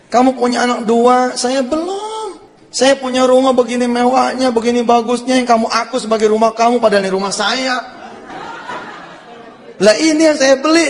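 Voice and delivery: high, insistent pleading reproach; acted heartfelt protest. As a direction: build from envy to a high exclamation. Rich friend confronts the boastful friend.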